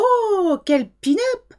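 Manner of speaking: high, swooping French coo of admiration; mock-impressed French exclamation, pitched right up; admiring French relatives. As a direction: imitate doting French family exclaiming. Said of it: "they would go".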